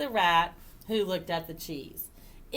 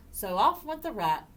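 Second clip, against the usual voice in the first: plain reading voice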